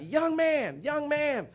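put-on high, loud old neighbour's holler; yelled call. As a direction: holler it across the street, stretched out high. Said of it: "she just yelled out".

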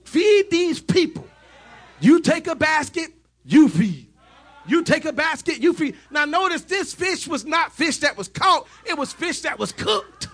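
high, loud shouted sermon; fiery revival preacher, shouting the climax; full-force preaching. Acted. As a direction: preach it out to the crowd at the top of your voice.

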